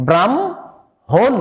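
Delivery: ব্রাহ্মণ slowly split as "bram-hon"; teacher's slow two-part "bram... hon" for ব্রাহ্মণ; correct pronunciation. Shown as a demonstration. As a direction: stretch ব্রাহ্মণ, m first, then h. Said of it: "এই উচ্চারণটা হবে".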